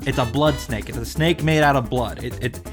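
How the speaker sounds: ordinary talk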